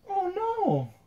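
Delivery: put-on girl crying out. Giant girl heard by Zavi, high startled cry. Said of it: "the sound of a girl go".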